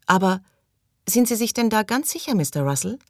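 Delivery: high, plaintive pleading question; whiny, tearful murmur. A narrator's reading. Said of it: "murmelte Maggie mit weinerlicher Stimme". (weinerlich, mit hoher Stimme)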